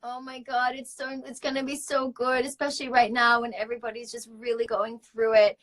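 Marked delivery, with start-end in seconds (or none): none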